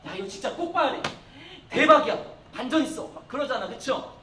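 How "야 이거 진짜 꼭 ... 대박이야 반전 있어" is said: raised, excited urging with a loud peak; gushing movie fan. Acted. Student raves to friends about a movie, excited gush. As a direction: act out hyping a film to a friend. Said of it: "여러분도 막 어떤 영화를 보고 나서".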